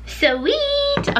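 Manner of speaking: high, bright cheer on one word; excited. Real, not acted. Lunch order placed, a high happy cheer. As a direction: celebrate the finished order.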